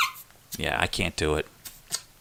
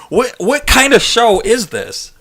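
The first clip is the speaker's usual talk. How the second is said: much higher, louder, slow outraged voice; mock scandalized viewer; 13 semitones higher, 8 dB louder, slower than usual. Shocked viewer reacting to the fart noise, hammed-up outrage. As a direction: play the shocked prude in the audience.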